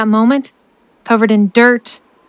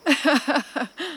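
quiet rising snicker; 5 semitones higher, 10 dB quieter than usual. Acted. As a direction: snicker at the joke. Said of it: "Rona snickered".

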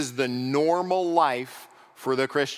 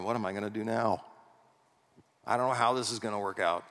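mock worried God voice; 2 semitones lower, faster than usual. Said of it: "That's my God voice, apparently".

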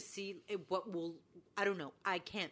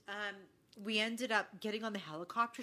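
normal talking voice

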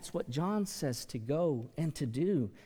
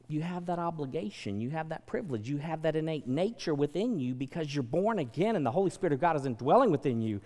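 ordinary delivery

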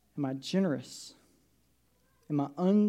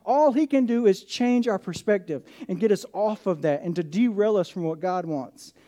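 raised, louder preacher's proclamation; preaching at full force; 6 semitones higher, 8 dB louder than usual. Acted. Pastor proclaims victory over hell, loud preaching. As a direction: pastor declares to the congregation, preaching hard.

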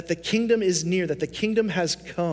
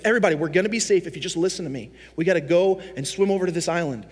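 hurried call; much faster than usual. Retold or imitated. Paul rallies shipwrecked crew.

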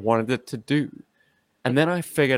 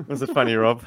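ordinary delivery

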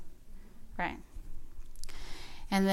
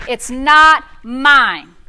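slow rebuke; 6 dB louder, much slower than usual. Shown as a demonstration. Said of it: "rise up and start using your mouth".

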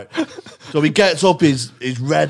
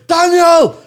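loud, high call down the street; 8 dB louder than usual. Retold or imitated. Calling the brother back down the street, a loud high shout. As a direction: shout his name after him.

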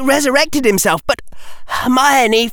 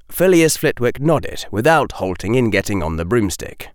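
ordinary delivery

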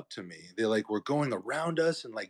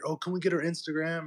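lightly imitated kid, higher pitch; mock pestering kid; 7 semitones higher than usual. Kids on bikes ask at the photo shoot, mock pestering.

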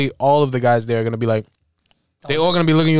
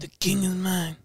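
softer mock pop-star voice; singer's talking voice; 2 semitones higher, 8 dB quieter, slower than usual. Showing how the singer talks, soft impression. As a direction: imitate the singer, softly. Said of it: "he talks like this".

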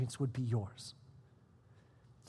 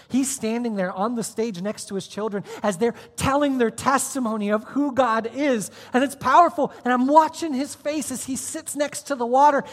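loud, high, building sermon story; preaching at full force; 10 semitones higher, 8 dB louder than usual. Acted. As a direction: carry the room, louder and higher.

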